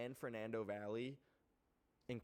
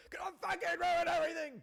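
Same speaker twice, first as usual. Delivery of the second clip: loud, high mock panic shout; panicked shout; 10 dB louder than usual. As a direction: yell it like a panicked elder. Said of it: "generational panic".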